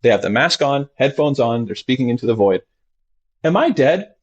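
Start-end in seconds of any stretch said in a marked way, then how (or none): 3.4-4.1 high, light question; patient's question. Retold or imitated. Therapist voicing a ketamine patient, high and light. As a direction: ask it lightly. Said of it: "in this tone of voice".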